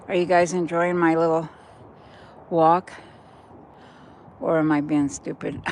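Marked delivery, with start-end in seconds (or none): none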